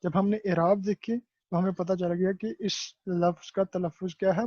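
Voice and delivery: ordinary talk, nothing marked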